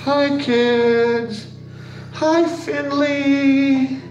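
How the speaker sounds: high, slow creepy puppet voice; sing-song monster under the bed; creepy playful under bed whisper. Pretend. Makeup artist voices creepy puppet.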